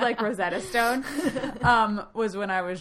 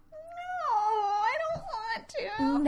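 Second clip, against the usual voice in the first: whiny refusal; 4 dB quieter, much slower than usual. Retold or imitated. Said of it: "And I'm like".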